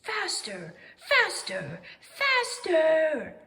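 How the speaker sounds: building from plain to a loud, high cry. A narrator's reading. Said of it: "cried Owl".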